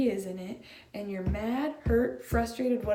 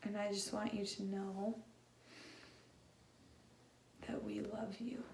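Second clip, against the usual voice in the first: tender hush; 11 dB quieter than usual. Real, not acted. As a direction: speak gently to hurting people. Tender pastoral aside to congregation.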